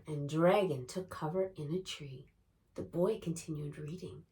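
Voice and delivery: plain reading voice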